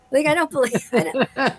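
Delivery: can't believe it, laughing it off; laughing disbelief. Real, not acted. Hearing the idol praised her version, laughing.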